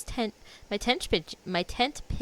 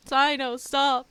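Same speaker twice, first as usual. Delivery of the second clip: higher and louder, mock scolding; playful protest; 6 semitones higher, 8 dB louder, slower than usual. Real, not acted. Streamer scolding Cyno's joke, louder mock protest. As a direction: tell him off, laughing it off. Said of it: "telling Cyno to stop".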